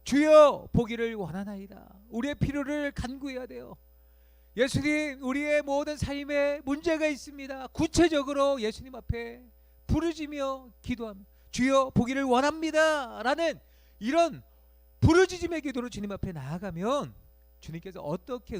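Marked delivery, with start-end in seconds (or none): 0.0-2.0 louder plea. Pretend. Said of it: "바디메오가 뭐라고 대답해요?". 2.1-17.1 loud, higher, driving exhortation; full-force preaching. Acted.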